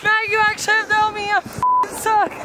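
ordinary talk

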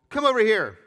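loud, high summons; calling out. A narrator's reading. Elijah summoning the people to the altar, calling out. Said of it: "Elijah called to the people".

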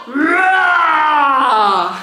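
long, loud roaring yell; demonstration yell. Shown as a demonstration. Coach showing children how to scream, loud roar. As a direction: let out a big drawn-out roar. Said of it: "ich schrei so".

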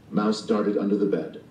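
plain reading voice

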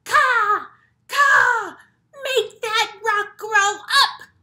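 loud, high, slow crow cawing; grumpy crow voice. Acted. Teacher showing the kids a grumpy crow's loud caw. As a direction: squawk it like a grumpy jealous crow. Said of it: "a grumpy face and a grumpy voice".

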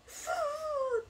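a long loud abdominal exhale, pushed out high; breathing demo. Shown as a demonstration. Streamer showing viewers her voice-training breath, long loud exhale. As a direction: show the belly breathing with one big breath out. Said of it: "腹式呼吸".

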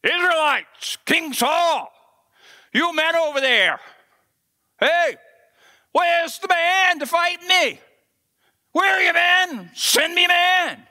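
high, loud challenge shouted across the valley; giant's taunting shout. Retold or imitated. Goliath yells a challenge to Israel's army, loud and taunting. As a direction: bellow a dare at the whole army.

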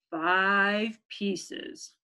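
measured parental reply, well below the whine; parents chiming. Acted. Mama and Papa P confirming the count, chiming. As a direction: chime it back evenly and firmly. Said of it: "they chimed".

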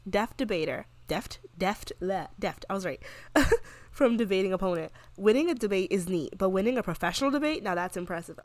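ordinary talk

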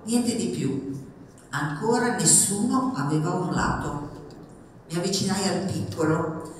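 expressive memoir reading, measured with pauses; story narration. A narrator's reading. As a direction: read your own story aloud to an audience. Reads her book aloud. (lettura narrativa espressiva ad alta voce)

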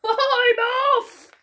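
high, loud panicked getaway cry; screamed. A narrator's reading. Fisherman flees the smiling shark, screaming. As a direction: yell it high like a fleeing cartoon fisherman. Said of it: "screamed the fisherman".

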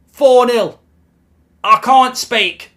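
loud, high, slow fury; furious shout. Real, not acted. Fan raging at the TV over four nil, furious shout. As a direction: bellow the score in disgust.

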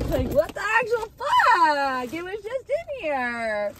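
high, drawn-out whine of disbelief; exasperated wail. Real, not acted. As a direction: wail it slowly, at your wits' end. Exasperated at missing pillow.

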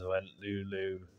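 put-on expressive read-aloud for a child, with pauses; bedtime story narration. A narrator's reading. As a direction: read a picture book at bedtime.

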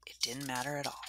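soft hushed whisper. A narrator's reading. Girl telling herself it didn't matter, whispered. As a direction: let it fall to a whisper of relief. Narrator's quiet resolve.

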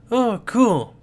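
high, loud burst of admiration; thrilled kid character, impressed; acted delight. Child character impressed by the monster.

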